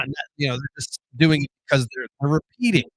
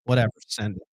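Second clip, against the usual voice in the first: ordinary talk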